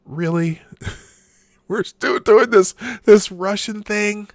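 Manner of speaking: laughing disbelief, slowing down on the last part; incredulous. Real, not acted. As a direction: scoff at the headline in disbelief. Mocking a news tweet.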